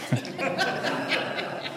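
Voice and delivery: soft laugh at his own joke; a short, quiet laugh after the punchline; quiet chuckle. Real, not acted. Preacher to congregation after his joke, quiet chuckle.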